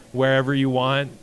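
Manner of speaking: ordinary talk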